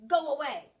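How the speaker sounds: loud, stern order to go away; stern bark. Acted. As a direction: shoo them off, loud and firm. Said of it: "Bruce was very stern".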